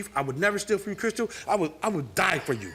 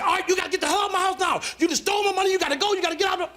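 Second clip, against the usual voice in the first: high, fast mock yell ordering him out; imitating her yelling; 11 semitones higher, faster than usual. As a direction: rattle it off like she's throwing him out. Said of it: "then you see how she yells".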